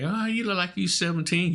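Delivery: high mock girl voice; a girl guessing his age, lightly voiced up high; imitating a girl. Retelling a girl at a concert guessing his age.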